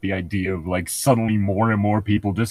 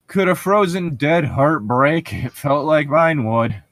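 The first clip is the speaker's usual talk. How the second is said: dramatic reading; 5 semitones higher, 4 dB louder, slower than usual. Acted. Dramatic reading of Twilight passage.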